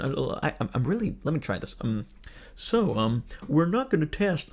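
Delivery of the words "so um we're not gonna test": put-on halting try at an English accent; half-hearted English accent, given up quickly; attempted English accent. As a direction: have another shaky go at sounding English. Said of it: "I cannot do the accent".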